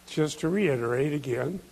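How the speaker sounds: usual speaking voice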